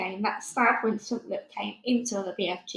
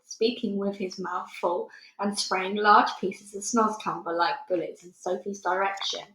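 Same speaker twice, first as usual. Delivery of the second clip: ordinary delivery, nothing marked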